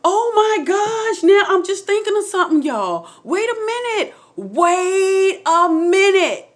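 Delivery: high, loud, drawn-out amazement; real astonished outburst, slowing right down; stunned realization. Real, not acted. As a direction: stretch it out as it dawns on you. Sudden realization mid-story.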